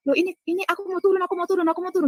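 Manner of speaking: high, insistent repeated plea; retold urgent protest. Woman trapped in the lift begs to go down, urgent. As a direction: re-enact pleading to be let out, pitched high.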